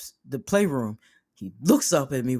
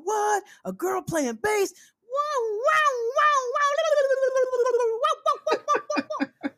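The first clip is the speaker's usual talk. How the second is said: squealing very high wows, laughing; slower than usual. Retold or imitated. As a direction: shriek with delight, way up high. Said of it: "He's like".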